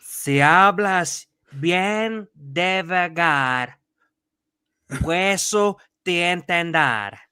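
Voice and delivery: slow, deliberate, word by word; slow careful Portuguese-accented Spanish. Shown as a demonstration. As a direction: spell it out slowly in Spanish. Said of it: "Tell me if this is correct".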